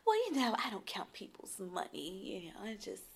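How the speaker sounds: mock hushed whisper, breathy and low; retold whisper. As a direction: whisper it like a half-secret brush-off. Retold whispering her brush-off line.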